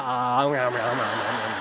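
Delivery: low babbling munching noise; imitated baby mouthing. Father imitating son mouthing the ball, low babble. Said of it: "opens his mouth and goes".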